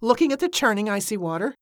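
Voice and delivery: plain reading voice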